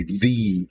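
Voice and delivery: THE said as "thee"; THE with an ee vowel; emphatic "thee". Shown as a demonstration. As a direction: say THE with a long ee for emphasis. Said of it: "trying to put an emphasis on it".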